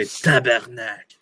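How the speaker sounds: hammed-up French accent. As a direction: blurt it in a mock Parisian French accent. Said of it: "I used a French, like, France accent".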